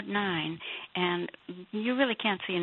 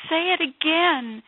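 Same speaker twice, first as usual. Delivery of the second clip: higher, louder and slow, begging; eager plea; 11 semitones higher, 9 dB louder, slower than usual. Retold or imitated. Inmate begging God to repeat his words, high and loud. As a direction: plead it out high and loud, slowly. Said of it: "And I stopped and I said".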